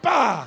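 shouted bah at the dog; loud bah. Retold or imitated. Pastor asserting himself over the puppy with a shouted bah. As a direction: bellow a short bah to make the dog submit. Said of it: "That's just what came out".

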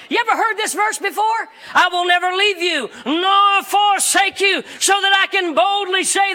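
high, emphatic pulpit delivery; full-force preaching. Real, not acted. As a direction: proclaim it with rising conviction.

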